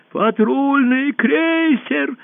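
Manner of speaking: high, stretched-out wail of alarm; panicked yell. A narrator's reading. Usurper about to flee the hall, panicked yelling. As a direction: wail it high and long, nerves gone. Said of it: "завопил узурпатор".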